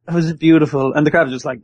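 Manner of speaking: ordinary talk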